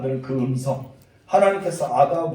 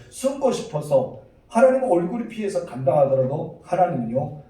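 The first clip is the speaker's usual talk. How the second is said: ordinary talk, nothing marked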